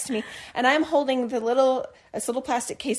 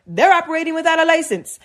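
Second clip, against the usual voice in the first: higher, louder officer's voice; policeman voice; 6 semitones higher, 5 dB louder than usual. Retold or imitated. Policeman explaining the raid, in a louder put-on voice. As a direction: play the officer explaining firmly.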